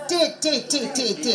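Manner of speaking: "T" repeated as a short "tee" syllable; rapid separate beats of "T", softly; stressed syllable isolated. Shown as a demonstration. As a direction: drill "T" over and over. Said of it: "with the stress syllable".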